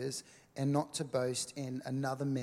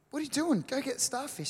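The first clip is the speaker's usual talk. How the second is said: high-pitched, incredulous retold dad; exasperated dad; 12 semitones higher, faster than usual. Dad calling to his son who turned back, exasperated. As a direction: act out a dad calling out in disbelief. Dad in story, more insistent.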